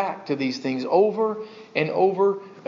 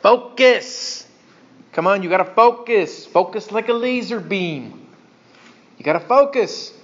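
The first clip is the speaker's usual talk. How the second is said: raised, urgent parental prodding; exhorting parent; 3 semitones higher, 3 dB louder than usual. Acted. Parent telling the kids to focus, urgent and insistent. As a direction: snap a child to attention, high and loud. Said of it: "You say that to your kids".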